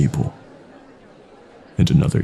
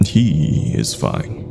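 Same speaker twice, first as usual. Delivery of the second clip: usual speaking voice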